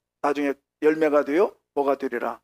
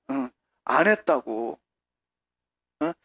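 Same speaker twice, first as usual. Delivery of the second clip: ordinary delivery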